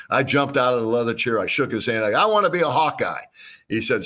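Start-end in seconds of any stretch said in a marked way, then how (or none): 2.1-3.2 eager high burst; excited. Retold or imitated. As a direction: blurt it out thrilled. Jumped up thrilled.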